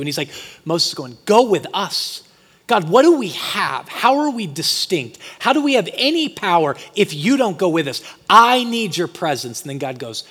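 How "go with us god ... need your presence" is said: loud, higher-pitched, urgent appeal; impassioned pleading. Retold or imitated. Moses begging God to go with the people, impassioned pleading. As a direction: plead it forcefully, pressing each question.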